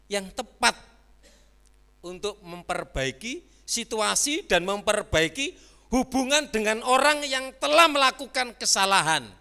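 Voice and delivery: rising loud fervent sermon; fervent preaching. Acted. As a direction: build to a loud preaching climax. Preaching at full force.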